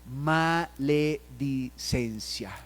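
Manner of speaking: the word "maledicencia" drawn out very slowly. Shown as a demonstration. Pastor reading out the vice "maledicencia", very slow. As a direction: stretch every syllable of "maledicencia". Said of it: "Y luego dice".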